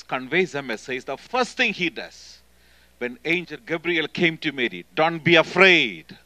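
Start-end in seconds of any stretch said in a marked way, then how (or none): 4.9-6.2 slow, much louder "Don't be afraid"; proclaiming loudly. Retold or imitated. Angel Gabriel announcing to Mary, loud and slow.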